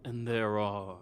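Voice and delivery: slow, measured ruler with long pauses; a hint of an unhurried sovereign; lightly voiced ruler. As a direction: let the words fall slowly, with weighty pauses. Game master voices a ruler among the party.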